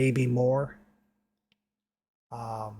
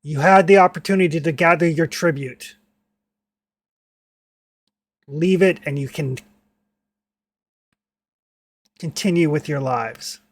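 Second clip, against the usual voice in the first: orc leader's ultimatum, firmly projected at the start, then easing; a mild bellow across a battlefield, not a full shout; restrained bellow; 2 semitones higher, 5 dB louder than usual. Acted. Orc addresses the village before battle, mildly bellowing. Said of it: "kind of bellows loudly".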